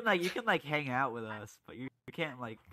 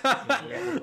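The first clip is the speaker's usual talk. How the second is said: excited; 14 semitones higher, 9 dB louder, slower than usual. Real, not acted. Excited news.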